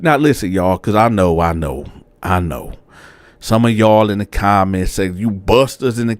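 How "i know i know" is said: normal talking voice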